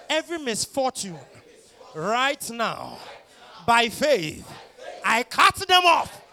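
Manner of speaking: high, shouted, building preacher fervor; righteous paladin declaration. Acted.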